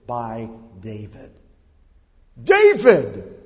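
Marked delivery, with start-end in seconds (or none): 2.4-3.3 high, very loud bellow of the name; contemptuous shout. Acted. Giant calls out King David without honor, contemptuous shout. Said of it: "David hears his name, but not in honor".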